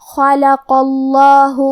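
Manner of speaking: اللہ with a thick, heavy lam after خلق, slow; heavy lam of Allah. Shown as a demonstration. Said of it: "بھاری آواز میں پڑھیں گے". (خلق اللہ میں لام بھاری آواز میں)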